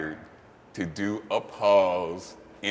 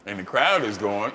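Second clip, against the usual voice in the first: ordinary talk, nothing marked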